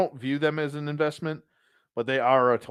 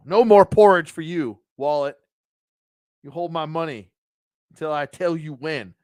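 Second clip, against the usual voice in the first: lightly voiced stern refusal, raised pitch; stern master; 5 semitones higher, 6 dB louder than usual. Man refusing his wallet more porridge, stern. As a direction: lay down the law, mock-stern.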